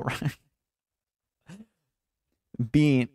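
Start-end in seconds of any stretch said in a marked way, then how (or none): 2.7-3.1 "Bean" with a long ee; "Bean" with the full ee sound; long ee vowel. Shown as a demonstration. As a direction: say "Bean" to rhyme with "green". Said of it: "pronounce it as Bean or Ben".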